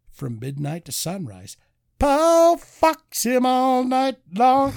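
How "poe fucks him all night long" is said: hammed-up slow punchline. Joke plot reading, drawn out.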